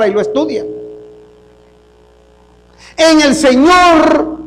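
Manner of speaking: exhorting the church about God's economy, preaching loud; full-force preaching. Acted.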